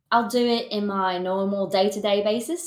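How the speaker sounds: a slip into a light Australian accent. Shown as a demonstration. American streamer slipping into Australian by accident, light Australian accent. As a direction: let the Australian vowels creep in unplanned. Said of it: "that was Australian".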